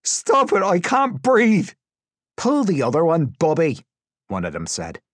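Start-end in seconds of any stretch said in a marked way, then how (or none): 0.0-1.7 loud, high, drawn-out scream; desperate high shriek for air; screamed plea. A narrator's reading. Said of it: "he screamed". 2.4-3.8 loud, raised scoffing voice; disbelieving taunt, high and loud; jeering captor. A narrator's reading. Captor jeering at screaming prisoner.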